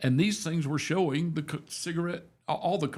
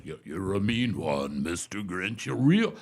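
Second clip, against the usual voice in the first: [name] impression; 6 semitones lower than usual. Man recalling a singer's Grinch song, imitating his deep bass. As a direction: sink low into a big bass, swelling at the end. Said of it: "It sounded just like the original".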